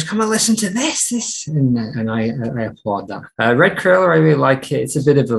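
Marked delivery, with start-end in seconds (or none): 0.0-1.5 imitating an excited call, pitched well up; mock excited call. Retelling Ezra calling the house to hear the song, mock excited call. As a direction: playfully retell someone calling the whole house over. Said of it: "calling everyone in the house".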